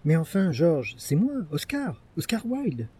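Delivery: incredulous Wilde begging the butler to recognize him; pleading. Acted. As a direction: plead to be let in, voice climbing.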